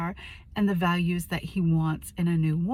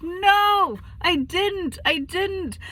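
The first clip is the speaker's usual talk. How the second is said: much higher and louder, insistent denial; upset protest; 11 semitones higher, 5 dB louder, much slower than usual. Retold or imitated. Wife protesting to husband's reproach, loud high denial. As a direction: blurt it out in a rush of protest.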